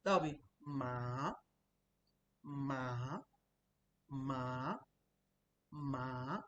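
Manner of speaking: "ma" dipping low then rising at the end, slow; dipping third tone. Shown as a demonstration. Teacher demonstrates the low dipping third tone on "ma". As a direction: dip "ma" down low and bring it up. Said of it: "down, up".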